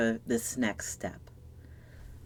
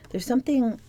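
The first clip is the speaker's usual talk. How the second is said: higher, brighter retake of the whispered line; lifted wonder; 9 semitones higher than usual. Acted. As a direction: say it again up high, marvelling. Same words repeated, lifted up.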